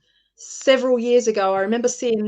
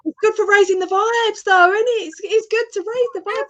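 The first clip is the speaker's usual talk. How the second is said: high-pitched, louder, bubbling after laughter; giddy; 11 semitones higher, 4 dB louder than usual. Real, not acted. Guest defending their giggling as raising the vibe, giddy. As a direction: say it high, still fizzing from the giggles.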